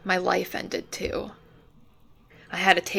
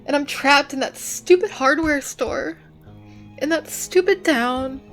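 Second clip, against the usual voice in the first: loud, higher, frustrated venting; anguished outburst; 4 semitones higher, 3 dB louder than usual. Acted. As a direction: spill out years of frustration. Emotional outburst about being trapped.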